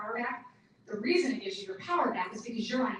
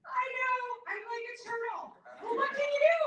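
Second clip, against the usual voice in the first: much higher, cheerful self-mocking shrug; mom joking about herself; 10 semitones higher, 4 dB louder, faster than usual. Mom joking to people behind her on the stairs, high and cheerful. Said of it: "she'd be like".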